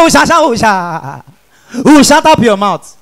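stuck on "Sha", then bursting out with the words; hammed-up stammer with hooting blocks. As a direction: stammerer fighting through to a retort. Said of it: "Now the idiot reply am back".

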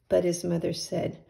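usual speaking voice

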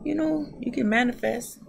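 ordinary talk, nothing marked

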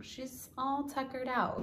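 high, soft puppy talk; soft cooing. Real, not acted. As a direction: murmur it tenderly to the puppy. Cooing over sleeping puppy.

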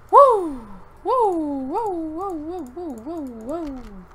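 high-pitched string of woos, fading; excited whooping. Real, not acted. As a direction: whoop high, then let it tail off. Whooping as deathmatch starts.

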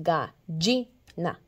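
"gallina" slowly split, ga-LLI-na; stress on LLI. Shown as a demonstration. Teacher showing where the force is, "gallina" with LLI stressed. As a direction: break up "gallina" and punch LLI. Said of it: "donde estaba la fuerza de la sílaba".

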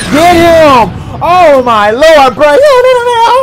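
loud, rising yells of panic; frantic yelling. Real, not acted. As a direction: yell at the screen as he breaks free. Fan yelling at defence on screen.

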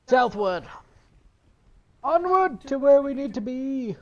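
loud, high pirate-captain cry; rally the crew heroically. Pretend.

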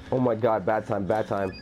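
ordinary talk

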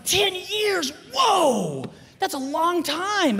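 high, stretched-out cry of amazement; astonished outburst. Retold or imitated. Comic reacting to his seatmate's answer, hammed-up astonishment. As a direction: echo it back way up high, hammed-up disbelief.

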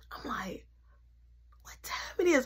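groggy, hushed and confused; sleepy whisper. Retold or imitated. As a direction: whisper it half awake.

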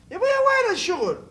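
high, whiny cry of despair; mock whine. To the office, a high whiny wail. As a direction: wail it like a fed-up kid.

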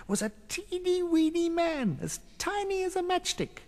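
very high, soft and tiny; squeaky small narration; teeny-weeny voice. A narrator's reading. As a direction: shrink the voice to matchstick size. Narrator mimics tininess.

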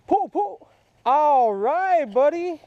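high, loud cheer as the fish bites; real excitement calling out to a nephew; excited shout. Real, not acted.

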